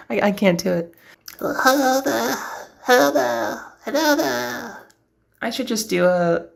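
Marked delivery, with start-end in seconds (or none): 1.4-4.9 gruffer, pushed hello, breaking into a laugh; goblin voice. Shown as a demonstration. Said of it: "lowering my larynx now".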